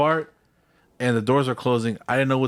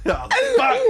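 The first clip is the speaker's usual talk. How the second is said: 1 laughing; 7 semitones higher than usual. Real, not acted. Laughing at the memory.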